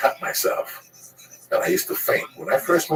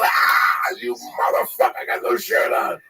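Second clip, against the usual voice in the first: pained outcry; 2 semitones lower than usual. Retold or imitated. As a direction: act out a clawed man yelling at his cat. Acts being clawed by cat.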